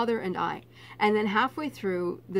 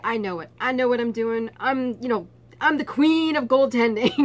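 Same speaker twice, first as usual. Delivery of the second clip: cocky; 3 semitones higher, 5 dB louder than usual. Retold or imitated. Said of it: "So I was pretty cocky".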